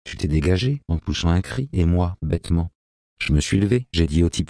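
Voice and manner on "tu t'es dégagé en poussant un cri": usual speaking voice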